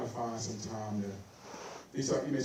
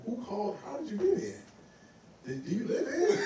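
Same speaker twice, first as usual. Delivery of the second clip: high, incredulous grilling, half laughing; mock suspicious questioning; 10 semitones higher than usual. Mocking the elders' questioning.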